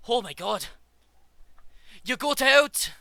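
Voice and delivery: high, loud, panicked cry; freaking-out scientist. Acted. Scientist spotting the escaped demon creature, high alarmed shout. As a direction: yelp it in alarmed shock, pitch shooting up. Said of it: "and he goes".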